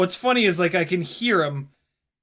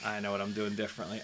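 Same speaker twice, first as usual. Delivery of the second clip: low, soft and fast, to himself; quiet realisation; 8 semitones lower, 13 dB quieter, faster than usual. Real, not acted. Pilot works out the mistake, quiet self-talk. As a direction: think it aloud under the voice.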